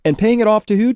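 ordinary delivery

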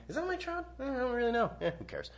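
high, soft, casual onlooker voices; indifferent passersby. Retold or imitated. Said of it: "people being like".

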